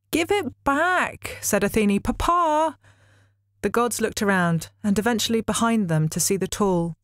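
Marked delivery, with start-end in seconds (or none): 0.1-1.4 very high, slow, wailing demand; childish whine. A narrator's reading. 2.0-2.8 put-on very high childish call. A narrator's reading. Calling out to Zeus.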